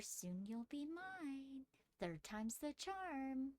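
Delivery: slow, soft, sinister whisper; menacing voice inside the skull; creepy creature voice. Acted. Creature's voice in the head.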